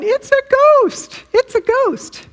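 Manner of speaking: high, loud mock yell; high-pitched retold scream. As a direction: imitate panicked grown men yelling out. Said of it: "they were screaming and yelling".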